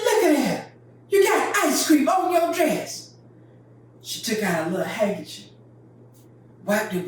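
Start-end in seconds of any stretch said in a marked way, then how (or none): 0.0-3.2 high, loud dismayed shout; mama scolding. Retold or imitated. Mama shouting at her daughter over the ice cream stain. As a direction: cry out at the stain. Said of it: "She said".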